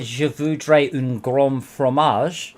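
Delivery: slow, careful French with an English accent; a hint of slow French sentence spoken by an English speaker; lightly accented French said slowly; French phrase. Shown as a demonstration. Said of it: "If I said".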